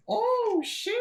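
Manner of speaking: very high-pitched, drawn-out reaction; high astonished squeal; real astonishment. Real, not acted. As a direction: squeal it in amazement. Surprised reaction to player's move.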